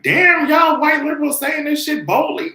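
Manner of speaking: loud, high exasperated yell; high-pitched outraged shout; mock shout. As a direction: shout it at them, stunned. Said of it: "I'm just like".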